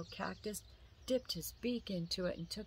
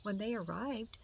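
usual speaking voice

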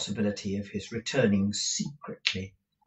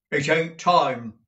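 dogged; 5 semitones higher, 8 dB louder, slower than usual. A narrator's reading. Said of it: "said Newman doggedly".

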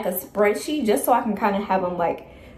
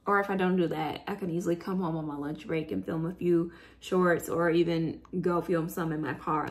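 ordinary talk, nothing marked

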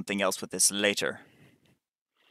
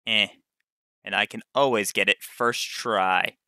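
normal talking voice

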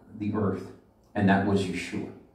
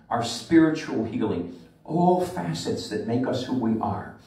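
normal talking voice

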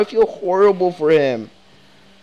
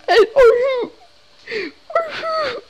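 high, wailing fake cry; hammed-up crying Kenny; mock sobbing; 4 dB louder than usual. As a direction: wail it like a hammed-up sob. Mock-weeping as Kenny to Duck.

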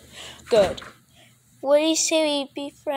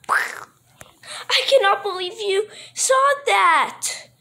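shocked; 7 semitones higher than usual. Acted. Shocked, slow and high.

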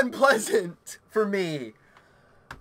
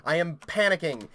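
normal talking voice

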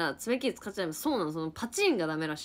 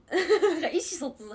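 louder and higher through a laugh; 8 semitones higher, 8 dB louder than usual. Real, not acted. Amused at the missed plan, laughing. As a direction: laugh the words out.

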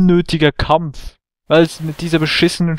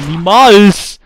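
defiant shout; 4 semitones higher, 7 dB louder than usual. Real, not acted. Defiant at enemy.

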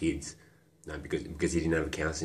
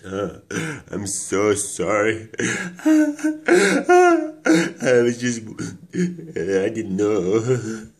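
loud, drawn-out wailing sobs; sobbing apology; 6 semitones higher, 11 dB louder, much slower than usual. Acted. Remorseful speaker begging the ear's pardon, sobbing apology. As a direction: break down crying, words stretched slow and high between sobs.

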